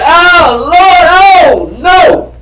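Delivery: high, loud wail of grief; mock wailing. Shown as a demonstration. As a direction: cry out to the Lord like a mourner. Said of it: "which means to wail".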